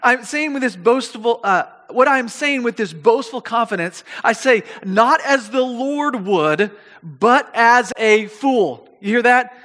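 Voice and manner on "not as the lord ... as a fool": slow, deliberate, every word weighted; preacher slowing right down on fool; very slow emphasis. Shown as a demonstration. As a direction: drag it out so it sinks in. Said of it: "you need to hear it like this".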